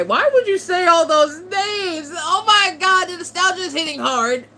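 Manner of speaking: choked up by nostalgia; near tears. Real, not acted. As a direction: voice breaking, high and loud with crying. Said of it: "You about to make me cry".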